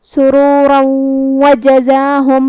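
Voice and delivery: سرورا with heavy ra, then وجزاہم drawn out slowly; tajweed demo. Shown as a demonstration. Teacher showing the joined reading, slow and careful. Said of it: "ہم یہ حکم کو ایسے ادا کریں گے".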